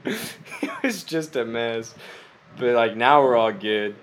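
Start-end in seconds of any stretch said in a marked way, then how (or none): 0.0-1.9 laughing. Real, not acted. Laughing about getting sick.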